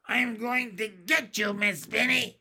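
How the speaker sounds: put-on high, loud child witch threat; muffled shout. A narrator's reading. Masked pupil runs up to her teacher, shouting muffled. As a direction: shout playful menace through a mask. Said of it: "shouted in her muffled voice".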